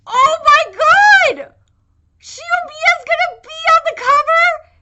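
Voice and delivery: loud, high burst of excitement; excited fangirl shout. Real, not acted. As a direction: shriek it, thrilled by the cover. Fangirl reacts to the cover.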